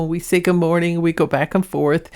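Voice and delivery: ordinary talk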